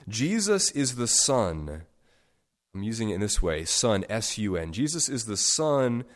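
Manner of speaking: ordinary talk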